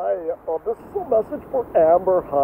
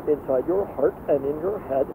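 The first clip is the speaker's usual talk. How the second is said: ordinary talk, nothing marked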